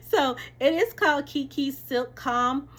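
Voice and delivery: high, loud product announcement; proud announcement. Real, not acted. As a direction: present the name like a proud host. Presenting product name.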